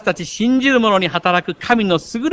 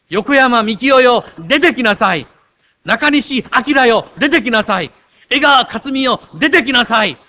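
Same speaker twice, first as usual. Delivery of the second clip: commanding call; 6 semitones higher, 6 dB louder than usual. Acted. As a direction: summon each person out of the grave. Jesus calling congregants by name. (名前を呼ぶ力強い大声)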